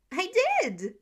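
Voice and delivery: put-on high, loud kid insisting; a boy defending himself; indignant child. Child protests to father.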